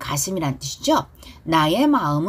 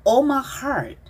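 slow, drawn-out phrase for repeating; slow pronunciation model; 5 semitones higher, much slower than usual. Shown as a demonstration. Teacher leading students in repetition, slow and deliberate. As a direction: stretch each word clearly. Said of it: "저를 보고 발음 따라하기".

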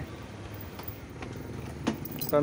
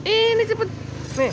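squeaky high urging to the cat; high coaxing voice. Real, not acted. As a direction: hurry the cat along in a high pet voice.

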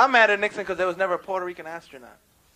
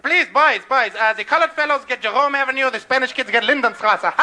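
put-on German-accented negotiator, loud and raised; Henry Kissinger; 8 semitones higher, 6 dB louder than usual. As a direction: plead with rival gangs in a German accent. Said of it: "Then there's Henry Kissinger, the great negotiator".

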